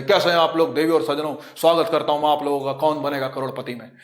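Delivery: teacher mimicking a TV quiz host's deep voice; Amitabh Bachchan impression. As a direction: welcome the audience like the KBC host. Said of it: "Amitabh Bachchan बन जाओगे".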